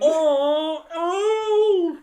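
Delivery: very high, loud gasping cries; mock excited cry. Father hamming up excitement over birds. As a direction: gasp with hammed-up delight.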